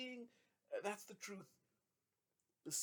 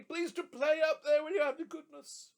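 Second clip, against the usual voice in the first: calling out; 13 semitones higher, 10 dB louder than usual. A narrator's reading. Trotty calls to band.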